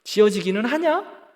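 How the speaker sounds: mocking, incredulous questions; sarcastic jeering. Preacher acting doubters of the vision center, sarcastic. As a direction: scoff that it will never happen. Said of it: "그렇게 비아냥거리면서".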